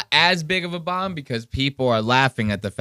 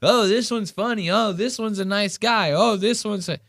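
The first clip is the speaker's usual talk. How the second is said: high-pitched, insincere gushing; mock praise; 10 semitones higher than usual. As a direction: parody comedians fawning over each other. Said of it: "I never trust them saying".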